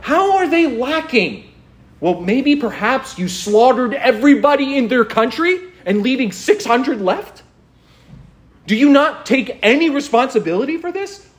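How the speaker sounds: high-pitched, sarcastic questioning; exasperated rhetorical grilling, pitch held up; incredulous scolding. Acted. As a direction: challenge them in open disbelief. Preacher scolds Israel with sarcastic questions.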